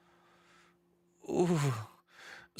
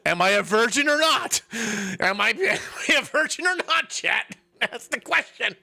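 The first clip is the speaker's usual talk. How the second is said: high-pitched, giggly outburst to chat; worked-up exclaiming; 6 semitones higher, 3 dB louder than usual. Real, not acted. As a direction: put the question out high and worked up. Exclaims the game's question to chat.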